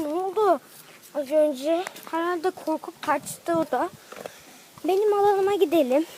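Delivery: ordinary delivery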